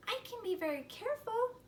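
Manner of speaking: put-on high, light little-girl voice; little sister voice. Little sister insisting to her brother, high and earnest. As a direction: plead in a small girl's voice.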